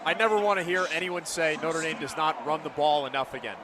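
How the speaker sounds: ordinary delivery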